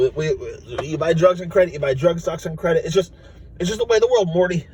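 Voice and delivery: lightly voiced Rick. Rick lecturing Morty, lightly voiced. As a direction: shrug it off as the way of things. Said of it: "Rick explaining credit to Morty".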